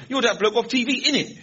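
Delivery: put-on high, loud London youth slang accent; young street slang accent. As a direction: mimic a cheeky lad's street accent. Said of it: "They all talk like that".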